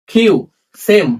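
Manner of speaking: ordinary talk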